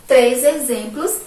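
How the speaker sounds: ordinary talk, nothing marked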